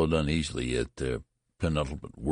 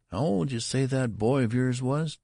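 a higher, pushier shop clerk voice; curious clerk; 8 semitones higher, 3 dB louder than usual. Acted. Clerk demanding of the father, higher and curious. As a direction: lean in, the clerk sizing him up. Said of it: "demanded the clerk curiously".

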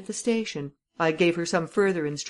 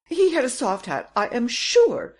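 higher, louder, stubborn; insistent repeat; 8 semitones higher, 6 dB louder than usual. Acted. Madame Renaud insisting to the commissary, higher, louder. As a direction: she won't be contradicted. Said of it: "repeated Madame Renaud".

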